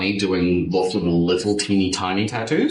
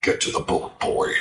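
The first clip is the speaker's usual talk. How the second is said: mock Kratos whisper; 6 semitones lower than usual. Mock Kratos ordering reading.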